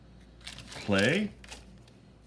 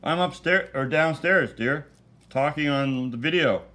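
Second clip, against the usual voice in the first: raised voice calling to another room; yelling across the room; 6 semitones higher, 7 dB louder than usual. Real, not acted. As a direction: call out to someone downstairs. Calling out to wife elsewhere.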